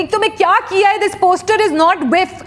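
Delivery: screaming at a volunteer over a poster; mock scream. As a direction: berate them down the phone. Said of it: "you just scream at them".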